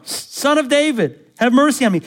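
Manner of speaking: crying out. Acted. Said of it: "He cried out all the more".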